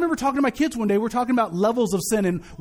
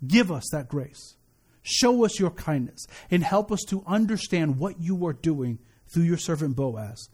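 slow, low, reverent praying voice; pastoral prayer; 7 semitones lower than usual. Real, not acted. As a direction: lead the congregation in solemn, measured prayer. Closes a congregational prayer.

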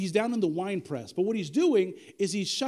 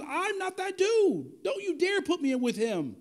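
high-pitched mock outburst, drawn out at first; acted angry denial, pitched way up; retold indignant yell; 10 semitones higher, slower than usual. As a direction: protest high and indignant, as if wrongly accused. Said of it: "Literally yelled and cussed at a guy".

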